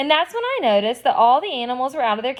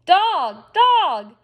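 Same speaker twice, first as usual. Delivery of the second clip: higher, louder squawked repeats; a bird shrieking the word over and over; parrot screaming; 6 semitones higher, 4 dB louder than usual. A narrator's reading. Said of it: "Gertrude kept screaming".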